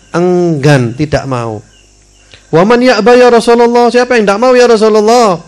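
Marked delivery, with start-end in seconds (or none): 2.5-5.4 high, loud eager question; questioning companion. Acted. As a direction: ask the Prophet urgently, voice raised high. Companions asking the Prophet.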